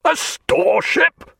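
shocked cry of disbelief; captain outraged at the store ship claim. A narrator's reading. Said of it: "cried Jack".

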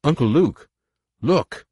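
high, loud excited cry; urgent call. A narrator's reading. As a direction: call out to get attention. Jacen calls out urgently.

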